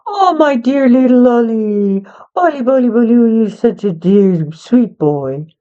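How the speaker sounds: sweet talk to a pet dog; doting pet talk. Acted. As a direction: coo over your beloved little dog. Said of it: "or you could say".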